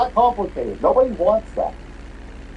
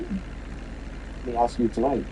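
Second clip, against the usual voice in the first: slow, hushed, lower anchor voice, laid on thick; mock TV host; 9 semitones lower, 9 dB quieter, slower than usual. Repeating the anchor's line to ridicule him, slow and hushed. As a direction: drag out the solemn host's question in a hush. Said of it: "Any follow-up to that, dude?".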